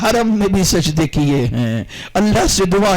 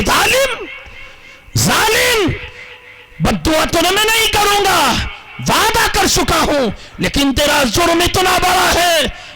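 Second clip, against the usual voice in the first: high, shouted furious scolding; a holy man's furious high shout at the sinner; angry rebuke; 10 semitones higher than usual. Acted. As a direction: blast him for his crime. Preacher voices Malik's angry rebuke.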